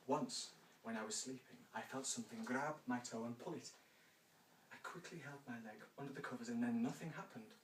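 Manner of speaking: low, hushed storytelling voice; a lightly spooky ghost-story read; ghost story telling. Acted. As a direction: draw the listener in with a spooky tale.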